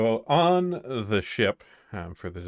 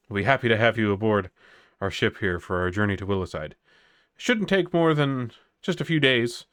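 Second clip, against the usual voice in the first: ship captain; 3 dB louder than usual. Acted. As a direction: greet the guests like a gracious sea captain.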